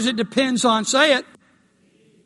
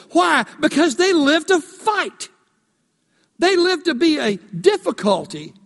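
impassioned preaching; 7 semitones higher than usual. Acted. Preaching at full force.

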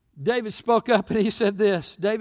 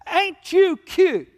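put-on slow, high sneering taunt at the boy; mocking giant; 8 semitones higher, 5 dB louder, much slower than usual. Goliath taunting young David, slow high mockery. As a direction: drag it out like a scornful giant.

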